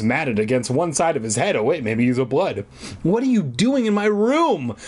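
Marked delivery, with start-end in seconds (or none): 3.0-4.8 higher, breathless demand; startled, breathless question. Acted. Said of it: "I demanded breathlessly".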